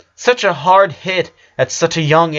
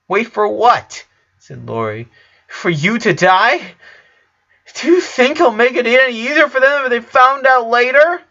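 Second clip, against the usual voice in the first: high, loud upset challenge; upset confrontation; 11 semitones higher, 4 dB louder than usual. A narrator's reading. Lori confronting her dying brother, upset and high. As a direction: push him hard for an answer.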